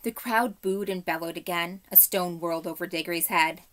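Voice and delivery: usual speaking voice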